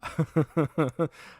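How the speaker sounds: loud, high laugh; hearty laugh in quick bursts; real laugh. Real, not acted. Laughs at the chat.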